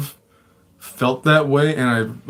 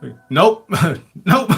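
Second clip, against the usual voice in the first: laughing through words; 3 semitones higher, slower than usual. Real, not acted. Laughs while retelling panic.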